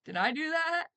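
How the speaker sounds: high nasal-free nerdy squeak; mock innocent oops; Urkel catchphrase. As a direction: play the goofy sitcom nerd.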